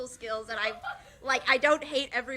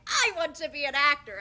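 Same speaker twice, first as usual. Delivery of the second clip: higher, louder proclamation; mock eagerness; 5 semitones higher, 6 dB louder than usual. Student denying early acting ambitions, mock-eager proclamation. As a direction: announce it like an over-keen hopeful. Mock quote of would-be actor.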